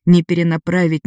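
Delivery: usual speaking voice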